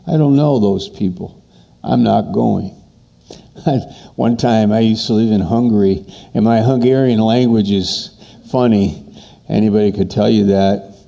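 ordinary talk, nothing marked

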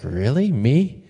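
lightly acted disbelief, rising on the last word; a hint of incredulous "who, me?"; doubting Gideon. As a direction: play a timid man questioning the compliment. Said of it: "And Gideon's like".

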